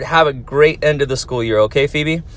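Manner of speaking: ordinary talk, nothing marked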